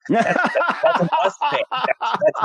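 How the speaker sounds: real amused laughter through the words; laughing. Real, not acted. Laughs at spirits' slang.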